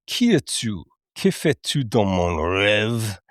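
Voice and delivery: slow, menacing French line; lightly voiced villain, drawn out in French; quoting Kronk in French. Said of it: "He says".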